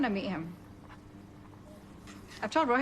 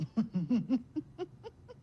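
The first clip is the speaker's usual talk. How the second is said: amused laugh; 5 semitones higher than usual. Acted. Laughing after mocking the matchmaking line.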